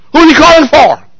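normal talking voice

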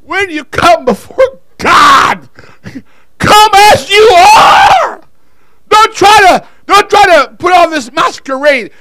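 loud, high, slow preaching shout; full-voiced shouted sermon to the church; preaching at full force. Real, not acted. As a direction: preach it to the rafters.

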